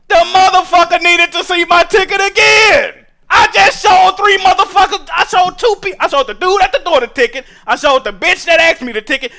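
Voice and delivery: high, loud shouting rant; furious rant. Real, not acted. Venting to listeners about the repeated ticket checks, shouting. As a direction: yell the grievance at the audience.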